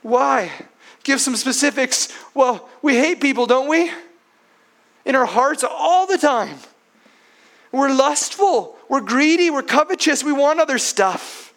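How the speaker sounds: loud, high, driving preacher's voice; fiery sermon delivery, pitched high and loud; preaching at full force. Acted. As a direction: hammer the charges home to the congregation. Preacher pressing the congregation.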